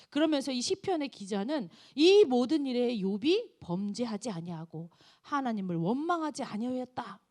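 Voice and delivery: normal talking voice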